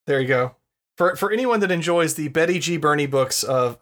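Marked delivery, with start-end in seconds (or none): none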